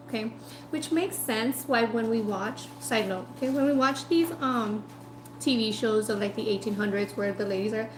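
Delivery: ordinary delivery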